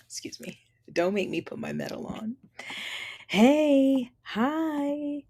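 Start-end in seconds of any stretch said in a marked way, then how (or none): none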